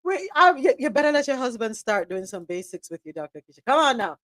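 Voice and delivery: ordinary talk, nothing marked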